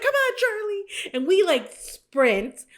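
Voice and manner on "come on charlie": high, loud urgent call to the dog; panicked call to the dog while fleeing. Retold or imitated. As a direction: yell for the dog to run. Fleeing owl, calling dog.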